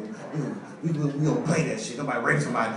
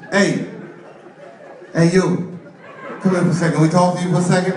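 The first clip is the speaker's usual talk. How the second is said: loud hail across the street; louder calls to a passerby; calling out; 5 semitones higher, 5 dB louder than usual. Acted. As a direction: get his attention from a distance. Gangsters call out to target.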